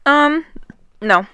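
small burp before answering; a little burp, then the word; embarrassed small burp. Real, not acted. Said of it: "Quit burping in the mic".

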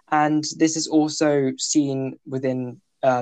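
usual speaking voice

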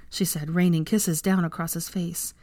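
ordinary delivery, nothing marked

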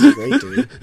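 laughing through the words. Real, not acted. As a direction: chuckle while you say it. Laughing at own joke.

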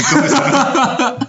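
high, slow, laughing tease. Real, not acted. Laughing as he dares a friend to eat the liver. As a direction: giggle out the dare.

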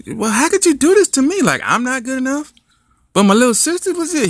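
high, wounded wail; high distressed cry; hurt girlfriend. Acted. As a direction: plead it heartbroken and high.

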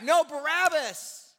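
loud, high, slow shout; crowd shouting. Acted. Crowd yelling back at Pilate, slow loud shout. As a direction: bellow it back as the mob. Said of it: "The crowd shouted back".

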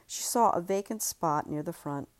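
ordinary delivery

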